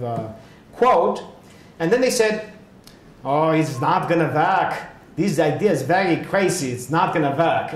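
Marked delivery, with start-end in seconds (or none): 3.2-6.7 put-on German accent. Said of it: "with that kind of accent". 6.7-7.7 put-on German accent on a verdict. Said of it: "with that kind of accent".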